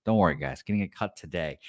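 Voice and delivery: normal talking voice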